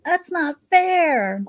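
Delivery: put-on high, slow whining girl; whiny girl. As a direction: drag it out like a sulky girl. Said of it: "The girl's always going around saying".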